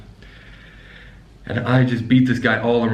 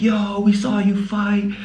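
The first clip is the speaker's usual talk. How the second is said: hyped-up crowd imitation; 12 semitones higher than usual. Hostel guests gushing about the fight they saw, mock excited squeal. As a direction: squeal it like thrilled onlookers. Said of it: "the whole hostel was going crazy".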